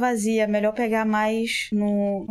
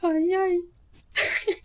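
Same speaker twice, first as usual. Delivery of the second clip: slow, high whimpering ai ai ai; frightened wail; 10 semitones higher, 3 dB louder, much slower than usual. Real, not acted. Gamer terrified in a dark corridor, whimpering slowly. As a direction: drag each ai out in fear. Scared while playing horror game.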